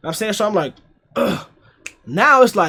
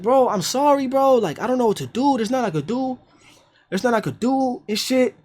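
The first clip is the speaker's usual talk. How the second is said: apologetic friend; 6 semitones higher than usual. Retold or imitated.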